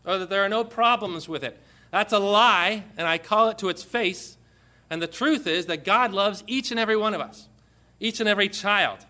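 loud, declaiming preacher voice; fiery sermon delivery; forceful scripture preaching. Acted. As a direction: proclaim it from the pulpit.

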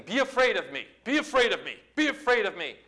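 high, insistent mock shout; shouted intimidation. Goliath coming against you, shouting to frighten. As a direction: hammer out each repeat as a threat. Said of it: "comes against you shouting".

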